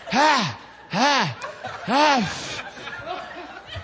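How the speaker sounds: exaggerated breathing-drill huffs; hyperventilating pants. Husband at the bedside in labor, hyperventilating pants. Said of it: "I'm hyperventilating".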